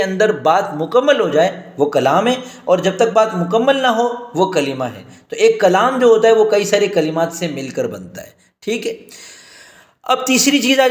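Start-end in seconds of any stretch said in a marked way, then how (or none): none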